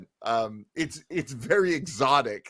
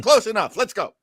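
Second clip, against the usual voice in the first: loud, higher brisk director's verdict; offhand approval; 6 semitones higher, 5 dB louder than usual. Acted. Director to auditioning actors, offhand loud approval. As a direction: wave it through, loud and higher. Director hurriedly accepting a poor accent.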